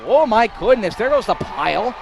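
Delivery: high, loud, rising call of the play; excited play-by-play. Real, not acted. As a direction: call the pile-up at full excitement.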